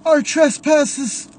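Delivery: high, loud tearful prayer; crying priest. A narrator's reading. Crying priest prays in the church, high and loud through tears. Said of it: "Priest crying".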